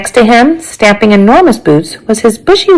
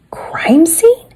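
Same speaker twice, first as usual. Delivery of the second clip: high, unvoiced-sounding whispered query; hushed echo of the question; 11 semitones higher, 4 dB quieter than usual.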